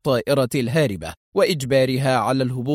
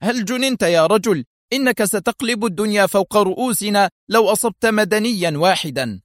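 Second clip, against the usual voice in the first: raised exclaim; 7 semitones higher than usual. Acted. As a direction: call out at your reckless partner. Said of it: "فهتف".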